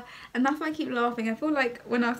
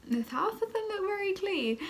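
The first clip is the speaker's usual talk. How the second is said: imitating mum, pitched much higher; mimicking mum's disapproval; 10 semitones higher than usual. Mum inspecting the untidy house, mocked in a much higher voice. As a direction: put on mum's voice way up high.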